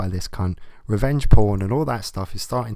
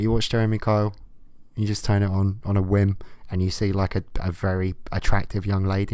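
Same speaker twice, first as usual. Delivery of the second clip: ordinary delivery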